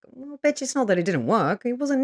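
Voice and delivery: high, sassy "Well, bitch"; sassy scolding; sassy retort. Real, not acted. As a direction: call them out with sass.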